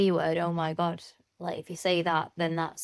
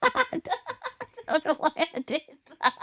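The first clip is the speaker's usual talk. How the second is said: giggling through the words; laughing; 9 semitones higher than usual. Real, not acted. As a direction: laugh while apologising. Laughing at own slip.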